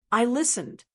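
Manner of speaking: ordinary delivery, nothing marked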